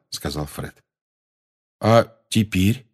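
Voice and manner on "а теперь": slow, careful question; cautious. A narrator's reading. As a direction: feel the way into the question, slowly. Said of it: "осторожно спросил Рон". (осторожно, медленно)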